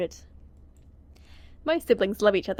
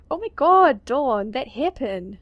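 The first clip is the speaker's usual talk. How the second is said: excited gushing; 3 semitones higher, 7 dB louder, slower than usual. Acted. [name] gushing over hairpin.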